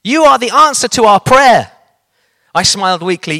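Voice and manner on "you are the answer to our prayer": put-on old lady amazed her prayer is answered; old lady telling the preacher he is God's answer, high and joyful; overjoyed old lady. A narrator's reading. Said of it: "one old lady trilled".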